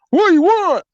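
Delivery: high, loud, suspicious demand; high suspicious call. Retold or imitated. Person behind the door demanding, high and loud. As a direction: bark it high through the door. Voiced door voice in retold story.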